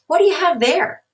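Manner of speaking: mother surprised at bags; curious mom. Acted. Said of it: "Tim's mom asked".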